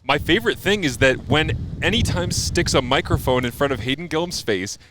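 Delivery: ordinary delivery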